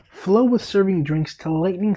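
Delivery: usual speaking voice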